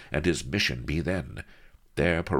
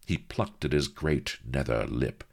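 plain reading voice

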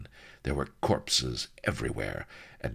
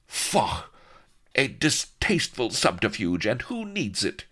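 higher, disdainful noblewoman; haughty cry; 8 semitones higher than usual. A narrator's reading. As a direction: dismiss it with lofty disgust. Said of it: "cried haughty Vanity".

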